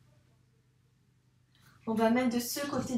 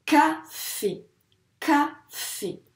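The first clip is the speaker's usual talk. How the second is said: café split as ca - fé, ca higher; syllable by syllable; 5 semitones higher, much slower than usual. Shown as a demonstration. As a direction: break café into two slow beats. Teacher models word by syllables. (café découpé : ca - fé)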